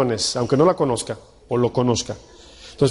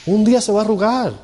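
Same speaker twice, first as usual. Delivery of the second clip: emphatic repeat; 7 semitones higher, faster than usual. Shown as a demonstration. Pastor warning husbands about aging wives, emphatic high repeat.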